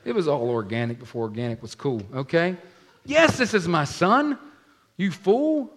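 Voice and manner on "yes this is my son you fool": exasperated parent. Acted. Parents voiced in retold story.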